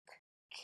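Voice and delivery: k, k, breathed as a bare k sound; sounding out words. Shown as a demonstration. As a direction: isolate the k sound, unvoiced. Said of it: "I'm going to sound out the word".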